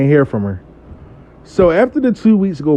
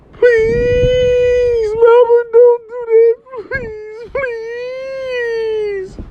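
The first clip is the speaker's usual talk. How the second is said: very high, drawn-out wailing beg; mock pleading wail; much slower than usual. Client begging spiritual worker not to shut it down, wailing plea. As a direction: whine "please" long and high, fading off. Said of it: "All I heard was".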